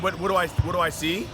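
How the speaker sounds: ordinary talk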